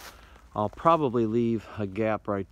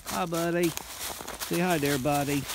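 much higher, slow pet-talk voice; talking to the dog; 8 semitones higher, slower than usual. Real, not acted. Hiker greeting his dog, in a high, slow pet voice.

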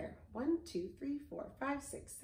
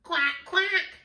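high, loud quacks; two punchy duck calls; 7 semitones higher, 6 dB louder than usual. A narrator's reading. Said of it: "says Duck".

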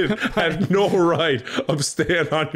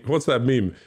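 normal talking voice

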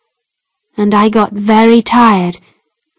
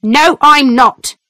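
angry denial; 3 semitones higher than usual. A narrator's reading. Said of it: "my mother answered angrily".

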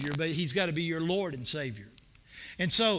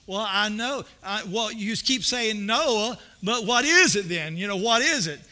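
exasperated question; 3 semitones higher, 6 dB louder than usual. Retold or imitated. Abbott and Costello style routine.

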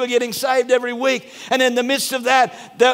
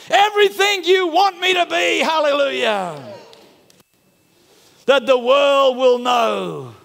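fervent shouted prayer; slower than usual. Real, not acted. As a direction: cry out to God.

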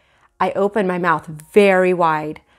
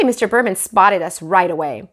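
ordinary delivery

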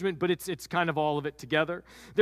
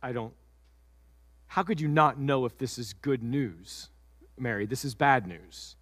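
upset Joseph; 7 semitones lower than usual. Acted.

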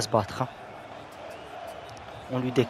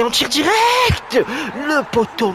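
excited shout; 12 semitones higher, 6 dB louder, much slower than usual. Real, not acted. Shooting in football game.

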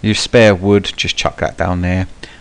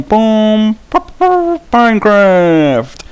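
zombie voice; 8 semitones higher, 6 dB louder, much slower than usual. Pretend. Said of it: "That's my impression of a zombie".

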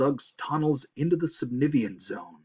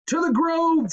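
high, loud squirrel shout; high-pitched urgent command; rallying cry; 13 semitones higher, 5 dB louder than usual. A narrator's reading. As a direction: call it out to urge him on. Squirrel rallies the fleeing moose.

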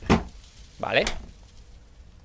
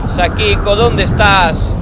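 higher, louder, drawn-out question; slow loud call; 5 semitones higher, 7 dB louder, much slower than usual. Real, not acted. Looking for Sakiko, a slow drawn-out loud call. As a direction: call slowly into the empty house.

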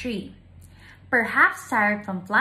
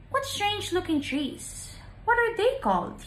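high, wondering bird voice, rising on the question; a small bird staring at stumps, amazed; curious little bird; 8 semitones higher than usual. Acted. Pipit asking Kamagong about the stumps, high and curious.

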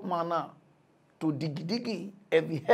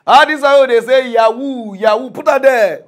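loud rant; 5 semitones higher, 12 dB louder than usual. Real, not acted. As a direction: preach it loud at the crowd. Heated rant about pastors.

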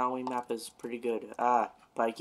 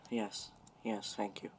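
normal talking voice

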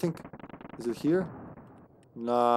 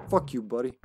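ordinary talk, nothing marked

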